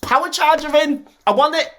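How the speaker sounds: high, eager wanting; breathless little gush of want; excited gushing. Real, not acted. Vlogger gushing at the Mario coins. Gushing over the Switch back.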